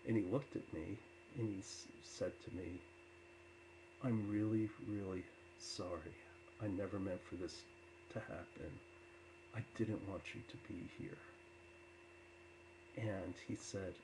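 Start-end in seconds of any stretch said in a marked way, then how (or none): none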